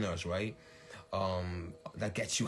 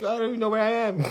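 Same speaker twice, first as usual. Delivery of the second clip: high, fast, lightly voiced lost rambling; flustered confusion; 11 semitones higher, 9 dB louder, much faster than usual. Streamer recalling her confused moment, lightly flustered. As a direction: sound a bit scattered and caught out. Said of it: "my little moment of like".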